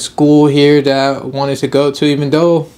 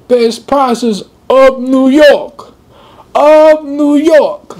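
high, loud, drawn-out street vendor call; New York street seller; 12 semitones higher, 6 dB louder, much slower than usual. Retold or imitated. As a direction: hawk products on the bridge, stretched and loud. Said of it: "this guy was doing a weird accent".

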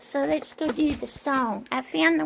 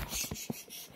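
soft repeated hush; shushing; 18 dB quieter, faster than usual. Real, not acted. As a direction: shush the dog in quick bursts. Hushing the dog.

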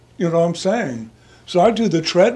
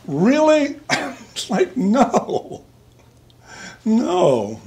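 mock disbelief; 7 semitones higher, much slower than usual. Real, not acted. Mock incredulity.

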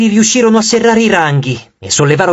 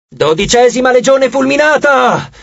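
high-pitched rallying call; raised, hoarse war cry; hoarse battle cry; 6 semitones higher than usual. A narrator's reading. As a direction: proclaim the legion's name in battle. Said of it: "gridò Reina con la voce roca".

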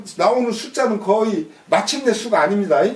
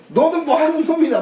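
mock scolding, pitched up; 5 semitones higher than usual. King rebukes another lord's retainer, mock scolding. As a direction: put on a higher-voiced challenge. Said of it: "꾸짖는 것은".

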